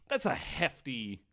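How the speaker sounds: ordinary talk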